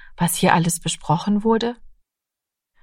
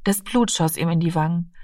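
ordinary delivery

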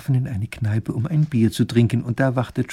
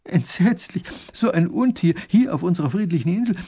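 high, fussing horror; horrified dismay; 6 semitones higher, 4 dB louder than usual. Acted. Lady hearing of a dragon on the island, horrified. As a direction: lament in fright, pitch raised.

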